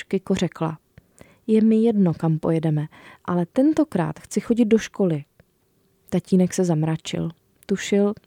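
put-on reading a children's book aloud; story narration. A narrator's reading. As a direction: tell it warmly to young listeners. Author reads her book aloud.

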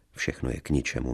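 ordinary delivery, nothing marked

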